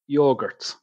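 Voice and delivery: yogurt said with a long yo as in go; yogurt, own way. Shown as a demonstration. Host explaining two ways to say yogurt. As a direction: yogurt with the first syllable like yo-yo. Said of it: "or as some of you will call them".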